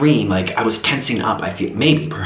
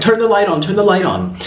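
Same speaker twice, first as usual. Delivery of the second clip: frantic plea; 7 semitones higher than usual. Retold or imitated. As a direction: insist in near panic, pitched up. Insisting again after his question.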